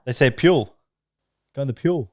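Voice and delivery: pool said as "pure", with a y-glide; put-on Aussie vowel. Shown as a demonstration. Showing friends how some Australians say pool, put-on Aussie vowel. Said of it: "I'm trying to put it on".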